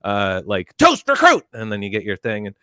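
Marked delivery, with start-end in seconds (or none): 0.8-1.4 very high, loud bark; recruit's shouted call. Retold or imitated. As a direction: belt out the order like a drilled recruit. Acting out chow line call.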